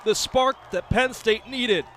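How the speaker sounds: shouted high and loud as the shot goes in; excited goal call. Real, not acted. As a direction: yell the goal like the crowd just erupted. Goal call by play-by-play announcer.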